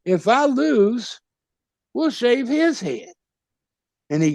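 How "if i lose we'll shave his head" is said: higher, slow, drawn-out mock dare; milk the dare for the crowd; retold slow challenge. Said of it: "And I said".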